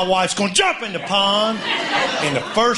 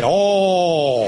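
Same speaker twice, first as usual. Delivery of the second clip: cow's groan. Retold or imitated. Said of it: "what the cow said".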